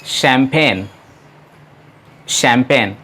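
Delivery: champagne said as "shampen", soft sh and silent g; correct pronunciation. Shown as a demonstration. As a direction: say champagne with a French sh and no g. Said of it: "इसे pronounce किया जाता है शैम्पेन".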